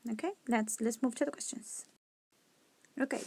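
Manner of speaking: ordinary talk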